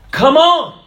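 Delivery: high, loud cry of "Come on!"; shouted. Acted. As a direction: call out like the crowd in the grandstand.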